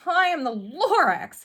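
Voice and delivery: high, loud croaky creature cry; loud high Lorax complaint. A narrator's reading. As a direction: bark it out as the grumpy Lorax. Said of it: "he coughed and he whiffed".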